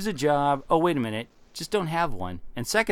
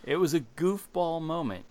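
ordinary talk, nothing marked

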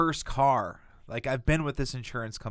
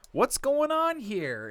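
high, slow, drawn-out exasperation; exasperated demand; 11 semitones higher, much slower than usual. Retold or imitated. Calling the local agent, a slow fed-up demand. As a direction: stretch it out, fed up and pointed. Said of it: "and I'm like".

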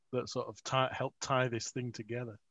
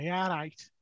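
higher-pitched thick Scouse greeting; thick Scouse accent; 6 semitones higher, 4 dB louder than usual. Retold or imitated. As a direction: put on a broad Scouse accent. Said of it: "spoke with this really thick Scouse accent".

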